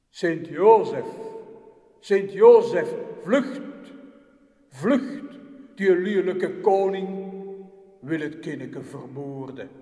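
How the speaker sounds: loud, urgent angel calling out; an angel shouting a warning into a dream. Acted. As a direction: call him awake in alarm. Angel calls Joseph in dream.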